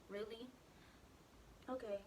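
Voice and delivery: ordinary delivery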